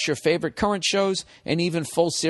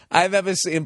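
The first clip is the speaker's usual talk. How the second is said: usual speaking voice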